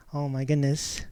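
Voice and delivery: normal talking voice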